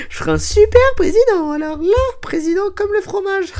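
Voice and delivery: very high, loud falsetto boast; silly squeal. Pretend. Host imagining himself as president, silly high-pitched squeal. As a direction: squeal it in a daft, giddy voice.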